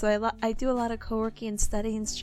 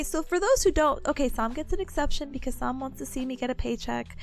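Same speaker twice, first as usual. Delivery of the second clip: usual speaking voice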